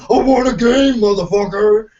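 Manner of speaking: loud, high mock demand at the employee; hammed-up furious yell; mock angry yell. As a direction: bellow it like you've pinned him down. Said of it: "tackle his ass and tell you".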